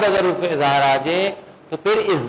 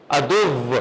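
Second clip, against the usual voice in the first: ordinary talk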